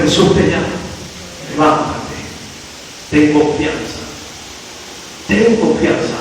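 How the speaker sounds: hushed, low and gentle; soft preaching. Acted. Preacher voicing the crowd to Bartimeo, hushed and low. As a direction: draw them in softly.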